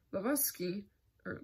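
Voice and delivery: usual speaking voice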